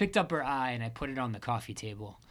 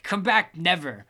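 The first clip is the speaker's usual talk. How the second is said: loud high shout, fading on never; 5 semitones higher, 9 dB louder than usual. Acted. Angry woman shouting through the door. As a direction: fling it at him, shouting. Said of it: "shouted Angelique".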